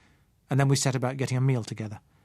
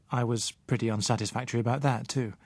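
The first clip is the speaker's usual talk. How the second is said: ordinary delivery, nothing marked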